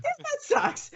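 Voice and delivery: jumps way up high in mock dismay. Telling the actor who swore it would never happen again, high mock dismay. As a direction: squeak it out, pretend crushed. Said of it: "I was like".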